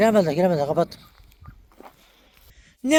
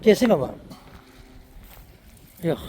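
normal talking voice

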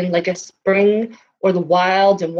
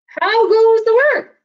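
much higher and louder farmer's call; 4 dB louder than usual. A narrator's reading. The lazy farmer shouts from bed, high and loud. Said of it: "So here".

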